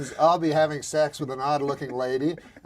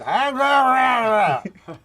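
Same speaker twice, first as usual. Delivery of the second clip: imitating Larry Flint; 7 semitones higher, 7 dB louder, much slower than usual. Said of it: "And he said".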